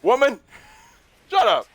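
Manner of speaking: high, loud outburst in two bursts; sharp, high shout; exasperated yell. Real, not acted. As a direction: yell at a noisy game enemy to be silent. Yells at a screaming game enemy.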